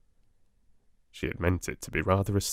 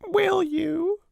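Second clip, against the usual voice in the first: very high small whimper; whimpering child; 4 dB louder than usual. A narrator's reading. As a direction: ask hopefully through sniffles. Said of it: "whimpered Lottie".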